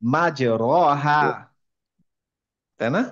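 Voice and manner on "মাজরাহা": মাজরাহা drawn out slowly with a full open আ; slow, stretched মাজরাহা with an open আ; মাজরাহা said slowly as the wrong open-a reading. Shown as a demonstration. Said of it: "কিন্তু উনি বললেন হচ্ছে মাজরেহা".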